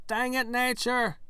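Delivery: high, loud mock-outraged cry; exasperated outburst. Player cursing nature after being snapped at, exasperated yell. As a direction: yell it in comic frustration.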